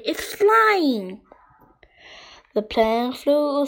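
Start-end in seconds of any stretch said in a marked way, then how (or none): 0.1-1.2 higher, thrilled exclamation; lifted, delighted cry; excited call. A narrator's reading. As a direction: call out the good news. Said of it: "called Henry".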